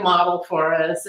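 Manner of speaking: normal talking voice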